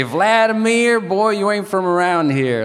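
loud, high-pitched Southern local voice; East Tennessee accent. Retold or imitated. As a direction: imitate a Southerner hollering at the newcomer. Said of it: "In East Tennessee".

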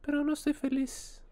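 very high falsetto cartoon mouse; Mickey Mouse voice. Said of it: "es Mickey Mouse, güey". (voz aguda de Mickey Mouse)

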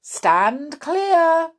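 high, loud command; calling a warning. Acted. Husband warns everyone away from Grandma, calling out. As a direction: call out to clear the area.

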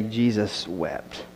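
reverent, very slow hush; slow hushed quote. Acted. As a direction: let the two words hang, hushed and slow. Quoting the famous short verse.

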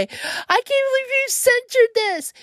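high-pitched mock outrage; complaining listeners. Listeners protest the censored podcast, high mock outrage. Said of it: "a lot of people will probably say".

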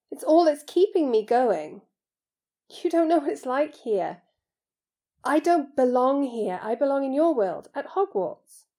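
lightly voiced boy, pleading; Harry, protesting. Boy protests he must return to school, pleading.

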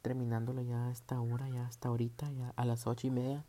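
whisper. Real, not acted. Late-night tired editing.

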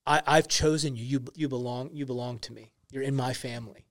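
softly voiced Jesus calling the woman daughter; tender Jesus. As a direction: hush down, like speaking to a beloved child. Voicing Jesus saying daughter.

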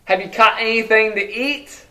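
calling out. Acted. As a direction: call out to the fishermen from shore. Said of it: "He calls out to them".

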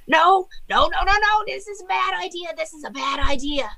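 high-pitched mock screaming; panicked book squawking as it burns; Joe Pesci. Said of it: "he's just screaming. I can't scream in the Joe Pesci voice".

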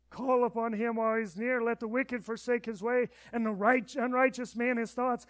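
booming, high proclaimed scripture; Isaiah read like a sermon climax; loud scripture reading. Acted. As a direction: proclaim the verse to the hall. Reading scripture at full force.

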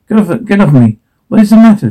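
panicked. Acted. Struggle, panic.

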